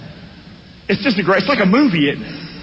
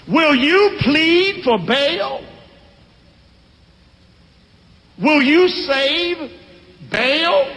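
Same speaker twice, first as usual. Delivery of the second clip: high, slow, declaiming defiance; a father standing up for his boy, slowly; defiant father; 5 semitones higher, slower than usual. Acted. Joash to the men who want to kill his son, slow high defiance. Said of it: "Gideon's daddy Joash said".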